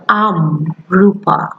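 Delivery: very slow, each word drawn out for learners; slow demo. Shown as a demonstration. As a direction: spell it out slowly like a teacher. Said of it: "তখন আমাকে বলতে".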